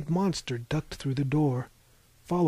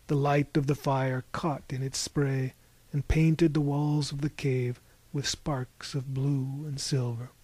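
plain reading voice

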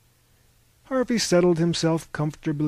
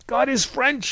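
high, loud retort; shouted rebuttal in a brawl; heated counter-cry; 11 semitones higher, 5 dB louder than usual. A narrator's reading. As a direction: fire it back much higher and louder. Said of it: "he countered".

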